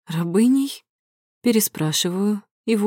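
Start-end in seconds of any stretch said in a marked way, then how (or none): 0.1-0.8 shocked. A narrator's reading. Mother horrified.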